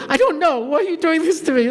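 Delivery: high, mock-distressed plea; flustered protest. Flustered girl pleads with the seminary head questioning her, mock distress.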